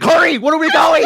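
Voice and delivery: high, loud panicked question; mock panic. Party panicking at the kobold news, high loud mock panic. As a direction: blurt it in a hurry.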